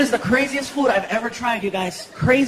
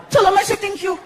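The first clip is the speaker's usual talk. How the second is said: put-on little kid; 10 semitones higher than usual. Son waking at night to cake, high child voice. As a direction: say it like an excited kid.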